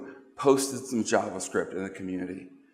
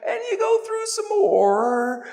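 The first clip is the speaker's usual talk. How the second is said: slow, rising, ever higher; drawn-out scrolling; 5 semitones higher than usual. Acted. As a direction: stretch it out, getting sillier. Mocking endless scrolling.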